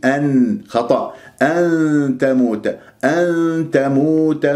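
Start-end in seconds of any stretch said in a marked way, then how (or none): 1.4-4.5 ن of أن تموت nasalised toward the ت, slow; hidden nun, ikhfa. Shown as a demonstration. Teacher models ikhfa of أن تموت. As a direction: hide the ن of أن into تموت. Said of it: "بهذه الطريقة".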